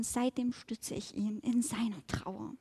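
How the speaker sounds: soft confiding whisper; whispered aside. Acted. Boar whispering to the fly about the fox. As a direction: lean in and whisper it like a secret.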